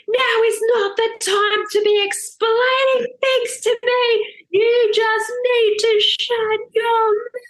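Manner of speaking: high, loud, slow and exasperated; furious mid-contraction. Retold or imitated. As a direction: tell him off through the pain. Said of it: "I'm having a contraction while he's trying to mansplain".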